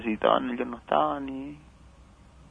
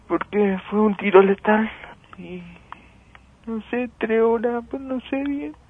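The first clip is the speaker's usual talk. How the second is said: a mother barely holding back tears; grief-stricken; 6 semitones higher, 7 dB louder than usual. Real, not acted. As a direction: voice rising and louder, breaking with grief. Mother recalls seeing dead son.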